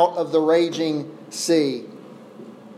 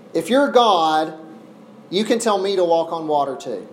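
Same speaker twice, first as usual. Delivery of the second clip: ordinary talk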